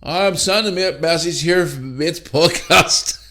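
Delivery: high, slow put-on voice of a tipsy friend in a pub; drunk mate impression. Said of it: "he was saying, like".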